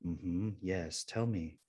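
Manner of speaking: soft, soothing automated listener; low, soft robo-priest acknowledgement; robot priest recording. Retold or imitated. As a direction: lightly imitate a recorded confessor, hushed. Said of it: "this recording comes on".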